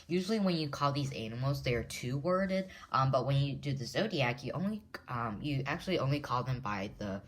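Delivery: ordinary talk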